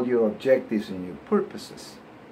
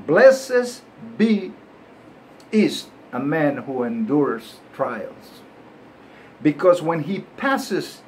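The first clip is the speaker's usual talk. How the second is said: Spanish-accented English; 3 semitones higher, 4 dB louder, slower than usual. Shown as a demonstration. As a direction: say the verse in a light Ricky Ricardo Spanish accent. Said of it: "this is with an accent, a bilingual accent".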